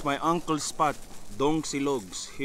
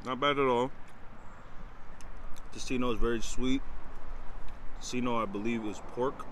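chewing between words; eating while describing food; talking with mouth full; 3 dB louder than usual. Real, not acted. Seen 2: spooning food from bowl to mouth throughout, head down.

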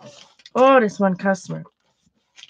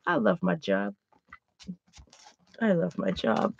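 muttered sarcasm, much softer; sarcastic mutter; 4 semitones lower, 10 dB quieter than usual. Retold or imitated. Barista after rude customer, quiet sarcastic mutter. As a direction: mutter it dryly under your breath. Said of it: "I was just like".

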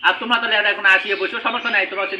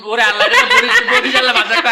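laughing; 3 semitones higher, 6 dB louder than usual. Real, not acted. Laughing at a friend's Barishal-sounding words. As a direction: burst out laughing at the dialect.

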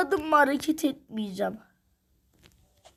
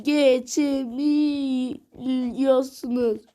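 geçemiyorsunuz stretched out very slowly, falling at the end; slow drawn-out taunt; much slower than usual. Real, not acted. Gamer teasing viewers they can't pass, slowly drawn out. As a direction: drag every syllable of geçemiyorsunuz.

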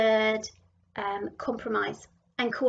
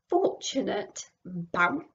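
ordinary delivery

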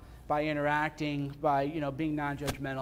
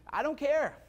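defiant; 9 semitones higher than usual. Real, not acted. Defiant answer to critics.

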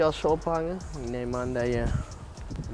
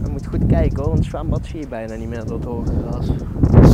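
words half buried in wind noise; wind on mic; 9 dB louder than usual. Real, not acted. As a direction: wind gusting over the microphone. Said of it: "Sorry voor de wind, mensen". (wind op de microfoon)

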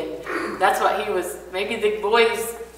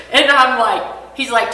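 ordinary delivery

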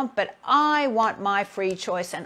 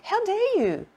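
much higher, shocked and outraged; indignant retort; 13 semitones higher than usual. Retold or imitated. Retelling telling off the shop girl with the gun, high outraged. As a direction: fling it back at her, scandalised.

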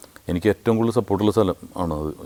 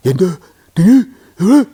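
squeezed voice like a duck grabbed by the neck; short strangled cry mimicking a friend's voice; his odd voice; 7 semitones higher, 7 dB louder, slower than usual. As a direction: choke the words out like a throttled duck. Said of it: "ഈ സൗണ്ടാണ് പുള്ളിക്ക്".

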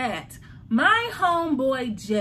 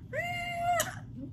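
knowing hum; 9 dB quieter than usual. Retold or imitated. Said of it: "we both were like".

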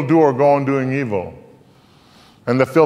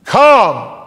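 high, loud cry of Come; ringing call; 9 semitones higher, 8 dB louder than usual. A narrator's reading. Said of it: "let each hearer reply".

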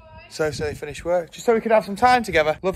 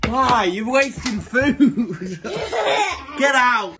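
higher, louder laughing outrage; laughing protest; 7 semitones higher, 4 dB louder, much slower than usual. Real, not acted. Dad caught out by the salted cereal, laughing protest. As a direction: protest while cracking up.

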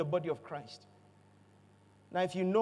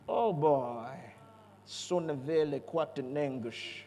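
lower, slow, murmured "tongues" voice; mock tongues; 6 semitones lower, slower than usual. Preacher imitating Jesus praying in tongues, mildly hammed-up. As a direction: drop low and drawl the made-up prayer words.

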